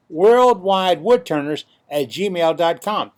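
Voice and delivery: slow, loud announcer read. Shown as a demonstration. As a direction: spell it out like a radio spot. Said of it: "here's the announcer voice".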